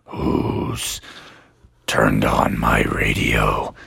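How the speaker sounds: very low, slow monster voice; deep, unhurried dragon growl. Acted. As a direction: rumble it like a waking dragon. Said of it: "the dragon".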